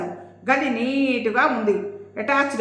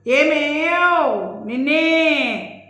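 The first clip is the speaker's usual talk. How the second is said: high, loud, slow drawn-out call; husband yelling for his wife inside; 4 semitones higher, 4 dB louder, much slower than usual. Acted. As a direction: bawl it into the house. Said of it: "ఓ గావు కేక పెట్టాడు".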